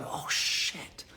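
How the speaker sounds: whispered under the breath, voiceless; inner whispered curse. Retold or imitated. To himself at the airline desk, a whispered oh shit. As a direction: let it slip out as a hushed private curse. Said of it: "inside I'm going".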